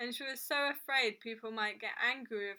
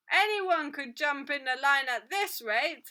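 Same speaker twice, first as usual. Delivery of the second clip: loud, higher grumble from the queue; raised, indignant customer's voice; impatient complaint; 4 semitones higher, 9 dB louder than usual. A narrator's reading. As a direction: pipe up crossly for all to hear.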